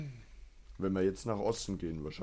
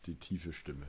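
deep put-on voice, lower and softer; extremely deep bass voice; 3 semitones lower, 6 dB quieter than usual. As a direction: drop into a low bass. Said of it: "ich mach dann heute die, die tiefe Stimme".

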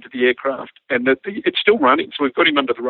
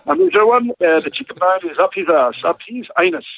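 mock official reply; 6 semitones higher than usual. Pilot giving absurd official radio answer.

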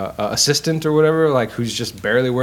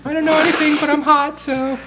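much higher, breathy-free ditzy bombshell voice, pitched way up; Marilyn Monroe impression. Friend mocks the blonde girl's movie dialogue in a high ditzy bombshell voice. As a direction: play the airhead blonde, all pitched high. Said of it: "talking like, uh, like Marilyn Monroe".

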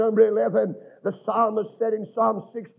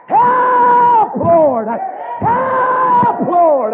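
high, loud, stretched-out shout; crying out; 12 dB louder, much slower than usual. Acted. Preacher urging a cry when demons growl, wailing shout. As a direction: holler it long and high. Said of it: "just cry".

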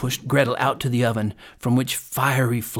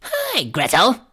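high crone call; witch calling; 8 semitones higher, slower than usual. A narrator's reading. Witch summons Gretel, calling out in crone voice. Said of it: "she called to the girl".

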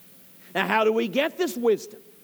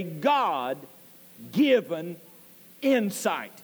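God-given low, long pause before insight; emphasis on God-given; 6 semitones lower, slower than usual. Real, not acted. Preacher explaining a definition, emphasis on God-given. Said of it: "let me put emphasis upon that".

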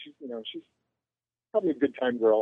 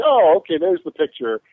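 feigned innocence; 4 semitones higher, 9 dB louder than usual. Retold or imitated. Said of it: "I'm like".

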